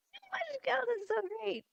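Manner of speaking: high, tearful squeak; real happy tears choking a softened voice; overcome with joy. Real, not acted. Overcome by joy at friends arriving.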